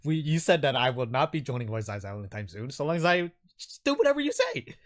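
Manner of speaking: high, loud, flustered pleading voice; eager nervous captive. Acted. Captive pleading with his captor over their deal, high and flustered. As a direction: agree frantically, then protest the deal. Game master plays captive man.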